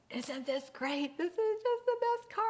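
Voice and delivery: high, loud, over-the-top delight; hammed-up thrilled husband; gushing excitement. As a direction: gush like a man in his dream car. Husband gushing over new car to wife.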